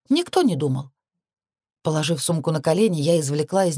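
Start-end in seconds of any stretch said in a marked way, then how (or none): 0.1-0.9 reproachful echo. A narrator's reading. Heroine echoes the commander's excuse back, reproachfully. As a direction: throw the excuse back at him.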